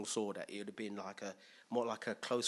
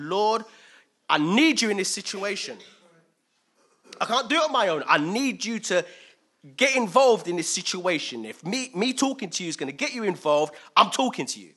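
loud, insistent plea, pitch raised; crying out; 5 semitones higher, 10 dB louder than usual. Acted. Preacher acting the psalmist needing God, crying out. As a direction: demand God's help at full voice. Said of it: "He's crying out to the Lord".

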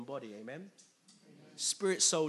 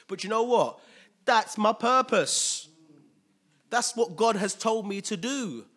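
Paul standing firm; 6 semitones higher, 9 dB louder than usual. Retold or imitated. Paul answering the pleading friends.

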